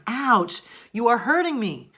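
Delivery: high, loud mock cry of hurt; acted pain. Teacher acting out a strong interjection example, crying out. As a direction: yelp it out as a complaint.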